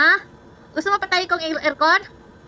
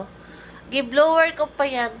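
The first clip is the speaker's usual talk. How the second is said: ordinary talk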